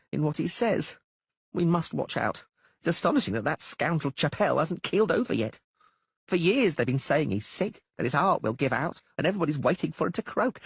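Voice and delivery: high, brisk halfling voice; halfling Dainty. A narrator's reading. Halfling Dainty brushing off the poet's fears, high and brisk. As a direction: tease the poet in a quick high halfling voice.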